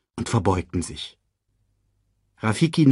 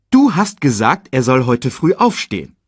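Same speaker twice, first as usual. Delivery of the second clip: high, loud, emphatic reproach; insistent reminder; 5 semitones higher, 6 dB louder than usual. A narrator's reading. Lioness nudges her husband to get up, insistent reminder. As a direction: remind him firmly with raised pitch.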